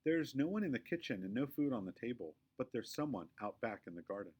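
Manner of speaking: ordinary delivery, nothing marked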